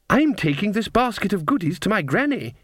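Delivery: put-on high-pitched child voice; little girl. A narrator's reading. Girl explains her basket to the witch, childlike. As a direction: answer brightly as a small child.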